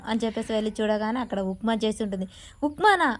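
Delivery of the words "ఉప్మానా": loud, high-pitched question; narrated shout, sharply raised; yelled disbelief. A narrator's reading. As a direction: exclaim it like an outraged complaint. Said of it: "గట్టిగా plate అయితే వెత్తేసి అరుస్తూ ఉంటాడు".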